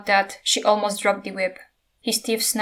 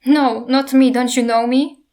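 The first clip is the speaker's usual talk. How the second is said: loud and higher, begging; a mother begging her own dragon; frightened plea; 3 semitones higher, 8 dB louder than usual. A narrator's reading. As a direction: plead with him to know her. Pleading with attacking dragon.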